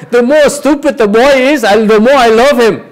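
high loud playful quote with laughter; hammed-up guru wisdom; quoting Guruji. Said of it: "Guruji says".

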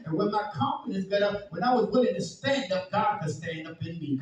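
loud, high, charged preaching; fiery preaching. Acted. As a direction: preach it at full force. Preaching at full force.

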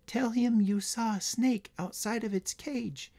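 high, slow little creature voice; tiny voice speaking inside someone's mind; ladybug voice. A narrator's reading. Ladybug prompts a boy what to tell the guard, high little voice. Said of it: "came a voice to Tyler's mind".

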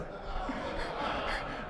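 voiceless chuckle, quiet; a soft airless laugh at his own joke; breathy silent laugh. Real, not acted. Preacher laughs quietly at his own joke, voiceless chuckle.

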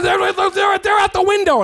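high, loud panicked yell; frantic shout. Retold or imitated. Guy in the improv shouts the warning. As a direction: yell the alarm in a rush. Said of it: "He says".

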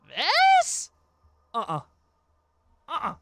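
very high squeaked this; incredulous. Real, not acted. Streamer scoffing at the statement, a very high incredulous squeak. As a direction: squeak it in disbelief.

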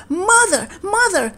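put-on high child's call; crying out. A narrator's reading. Boy runs back to his mother, crying out high. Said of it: "he cried".

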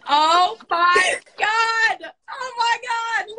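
high, drawn-out overjoyed cry; real thrilled shriek; ecstatic greeting. Real, not acted. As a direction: squeal with delight as they finally appear. Call finally connects to guest.

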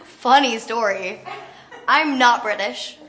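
slow, laughing through it; laughing confession. Retold or imitated. Confessing to Scorsese, laughing and slow. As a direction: admit the lie while laughing. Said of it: "And I was like".

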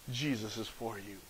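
very soft, low voice; quiet, low reassurance; hushed comfort. Acted. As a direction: drop right down to a near-murmur, as if consoling a prisoner. Voices visitor comforting Paul in prison.

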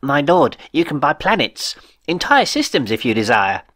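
a lightly voiced, assured official; confident accountant. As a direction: offer the galaxy smoothly.

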